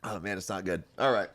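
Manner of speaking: ordinary talk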